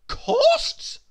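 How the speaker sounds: very high, loud disdainful question; lofty query. Acted. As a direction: toss the word up in haughty disbelief. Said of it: "queried Miss Splendid loftily".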